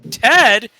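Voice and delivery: loud, high incredulous echo; affronted disbelief. Acted. Rogue hears the elf get his name wrong, loudly affronted. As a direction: throw the wrong name back, outraged.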